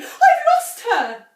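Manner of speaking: high, loud, slow anguished cry. A narrator's reading. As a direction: cry out in despair. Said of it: "cried Missus Cranky".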